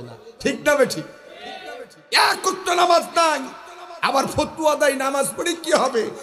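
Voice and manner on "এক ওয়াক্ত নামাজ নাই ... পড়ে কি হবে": high, loud preacher's shout against false piety; fiery preaching. Acted. Preacher shouting at the crowd about skipped prayers. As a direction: thunder it from the pulpit.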